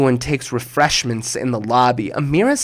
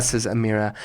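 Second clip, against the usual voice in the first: ordinary delivery, nothing marked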